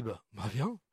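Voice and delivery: offhand, low, easygoing invitation; casual come on. Retold or imitated. Preacher imagining Jesus calling Peter casually. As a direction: toss it off lightly, no pressure. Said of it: "sur quel ton Jésus l'a dit ça".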